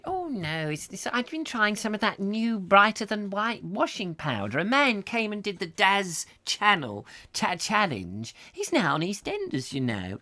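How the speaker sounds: put-on high prim old lady voice; high, prim neighbourly gossip. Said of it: "I've got to get out of character".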